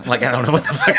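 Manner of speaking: ordinary talk, nothing marked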